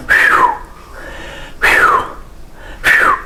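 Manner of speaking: a whistled breath out; relieved phew. Shown as a demonstration. Teacher demonstrating the relief sound, whistled phew. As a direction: blow out in relief. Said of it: "but we make the sound".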